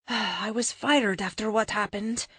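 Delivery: slow, subdued sad admission; sorrowful confession; sighing sadly. Acted. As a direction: admit it sadly, deflated. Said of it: "she says with a sigh".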